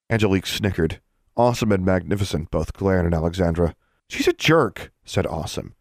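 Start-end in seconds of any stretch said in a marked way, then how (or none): 4.1-4.9 put-on high, huffy kid voice; high-pitched young character, miffed; offended youngster. A narrator's reading. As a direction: say it high like an affronted child. Awesome, glaring youngster, insults Alexandra.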